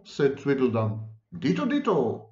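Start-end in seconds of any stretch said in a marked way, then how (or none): 1.4-2.3 high-pitched, only slightly raised cry; storyteller's restrained shout; mild shout. A narrator's reading. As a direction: call it out high without really shouting. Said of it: "He shouted this so loud".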